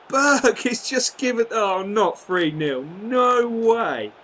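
groaning shout as his defender gifts a goal; dismayed yell. Real, not acted.

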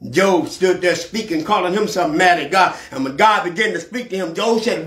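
ordinary delivery

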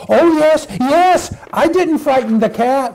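high, insistent puppy voice; little puppy. Acted. Puppy assures mother he has been good, little puppy voice. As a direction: protest innocence as a little puppy.

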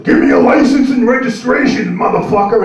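loud, high barking cop demand; cop yelling. Retold or imitated. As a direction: bark it like an angry traffic cop. Cop yelling at pulled-over driver.

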